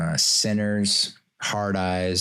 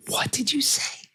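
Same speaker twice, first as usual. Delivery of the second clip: breathy, much higher, mock-outraged "What did you say?"; dramatic sarcastic. Shown as a demonstration. Father to daughter who spoke out of line, dramatic sarcasm. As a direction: whisper it high with hammed-up sarcastic shock. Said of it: "I do this in a very dramatic, sarcastic way".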